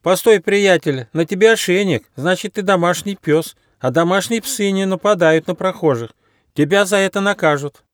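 high, pleading animal character voice; nervous little platypus. A narrator's reading. Platypus to a threatening dog, nervous reasoning.

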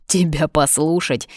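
ordinary delivery

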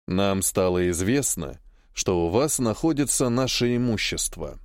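a hint of a cold stranger; male voice. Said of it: "на этот раз голос мужской".

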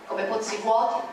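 usual speaking voice

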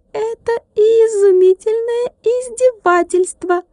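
high ringing tiny voice, outraged; a little glass man ringing with indignation; indignant ringing. A narrator's reading. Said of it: "возмущённо звенел Тилька". (возмущённо звенит, высоким тонким голоском)

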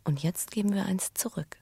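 whispered confession to her husband; hushed whisper. Acted. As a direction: whisper it softly to him. Intimate confession.

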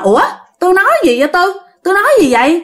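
bewildered. Retold or imitated. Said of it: "chị ngỡ ngàng".

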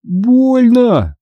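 high, drawn-out whimper of pain; whiny, plaintive cry; tearful whining. A narrator's reading. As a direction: complain like a hurt little boy. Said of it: "плаксиво пожаловался он".

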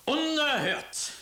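very high, loud and slow; outraged cry. Acted. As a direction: explode in indignation. Emperor outraged at Tibet.

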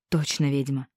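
breathed out in fear. A narrator's reading. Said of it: "выдохнул побелевший мужик".

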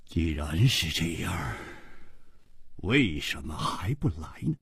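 low, soft, brooding question; frowning, puzzled. Acted. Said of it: "楚留香皱着眉说". (皱着眉低声琢磨)

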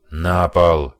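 loud barked command; shouting. Acted. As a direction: yell the order at the room. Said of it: "крикнул Стэн".

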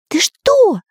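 high-pitched startled character voice; alarmed. A narrator's reading. Artist fears the spirit's temper, high frightened voice. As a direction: gasp the question in high alarm. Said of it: "перепугался Карандаш".

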